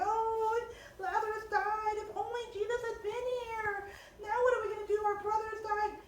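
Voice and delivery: high wailing lament, sister grieving; mock crying sister. Sister mourning her brother, high crying wail. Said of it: "the poor sister was crying".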